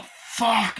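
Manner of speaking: high-pitched retold curse; acted shock at a gunshot; mock alarm. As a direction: jump up in pitch, startled. Acting out reaction to gunshot.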